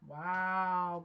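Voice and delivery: usual speaking voice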